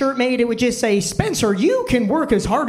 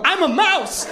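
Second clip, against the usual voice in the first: high mock yell; 9 semitones higher, slower than usual. Comic at the trainer, a high yelled mouse squeal. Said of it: "And I yelled".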